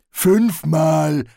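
cheerful troll. A narrator's reading. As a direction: announce it proudly as a troll. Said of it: "sagte Detritus fröhlich". (fröhlicher Troll, laut)

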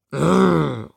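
long growled "grrr"; lion's growl. A narrator's reading. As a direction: growl like a shaggy storybook lion. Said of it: "knurrt dann der zottige Löwe". (Löwenknurren)

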